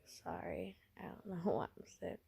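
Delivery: very soft apologetic sick voice; weak and sorry. Acted. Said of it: "I put my sick voice on".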